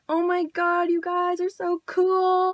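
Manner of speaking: high, drawn-out gushing voice; excited admiration. Retold or imitated. The girls to the kids who stole dad's truck, gushing high. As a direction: imitate impressed girls, high and gushy. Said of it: "they were, like, all excited".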